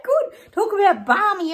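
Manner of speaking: high, laughing squeal of delight; giddy high. Real, not acted. Vlogger elated after the London march, giddy. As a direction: gush slowly through laughter. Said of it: "I'm on a bit of a high".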